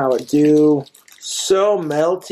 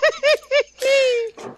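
tipsy high giggle. Real, not acted. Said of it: "I'm a little bit tipsy".

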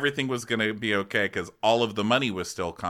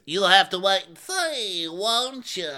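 mock Erica; 10 semitones higher, 4 dB louder, slower than usual. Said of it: "She goes".

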